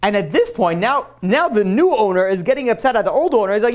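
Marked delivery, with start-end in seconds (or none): none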